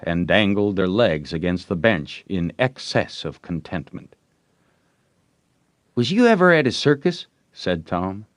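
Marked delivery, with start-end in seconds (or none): 5.9-7.3 put-on quick, higher boy's question; a lively boy showing off; schoolboy voice, eager; boyish Tom.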